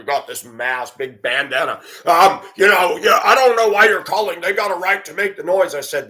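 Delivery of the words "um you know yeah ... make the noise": higher, half-shouted mock cop, dodging the complaint. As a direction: ham up a cop who shrugs it off. Voicing the cop dismissing the complaint.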